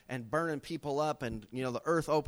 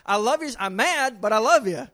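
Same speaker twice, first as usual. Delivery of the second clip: loud, higher, mock marital outburst; raised-voice playful spat with a spouse; exasperated affection; 6 semitones higher, 11 dB louder than usual. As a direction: act out being mad but still loving. Playful acting of a married spat.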